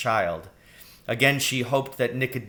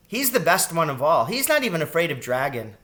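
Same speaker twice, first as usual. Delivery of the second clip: put-on higher, eager child's voice; raised young voice, full of admiration; admiring girl rat; 6 semitones higher, 4 dB louder than usual. A narrator's reading. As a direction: gush lightly like a young girl.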